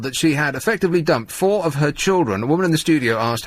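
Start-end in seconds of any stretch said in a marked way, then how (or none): none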